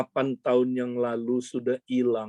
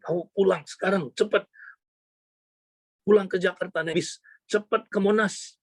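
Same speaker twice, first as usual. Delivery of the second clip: high, urgent hurrying command; retold urgent instruction, pitched up; acted urging; 6 semitones higher than usual. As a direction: send someone off at once. Mentors urge her to hurry.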